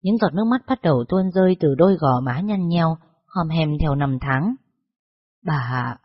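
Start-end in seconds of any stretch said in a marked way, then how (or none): 5.4-6.0 barely able to get the words out; choked up. A narrator's reading. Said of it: "cụ Liễn nói không nên lời". (nghẹn ngào, nói không thành lời)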